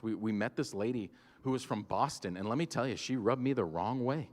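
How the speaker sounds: ordinary talk, nothing marked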